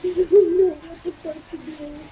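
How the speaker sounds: high, loud, drawn-out distress; retold helpless panic. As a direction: wail it in mock desperation. Retold panic stuck in dress.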